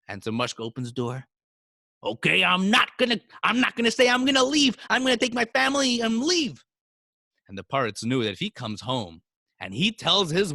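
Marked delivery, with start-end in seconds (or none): 2.0-6.6 high, loud, worked-up protest; raised voice, building indignation; defiant threat. Acted. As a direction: threaten loudly to walk out. Mushka threatens to leave.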